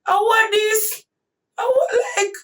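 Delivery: high, loud wailing cry; half-sobbed, high-pitched plea; reliving the tears, loud and high; retold crying. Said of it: "Me start to scream and me start crying out".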